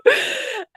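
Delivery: high sobbing breath between pleas; teary sob. Retold or imitated. Sob while complaining to Joey. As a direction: let a crying whimper out. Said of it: "I'm crying every night to Joey".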